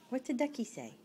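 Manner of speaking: normal talking voice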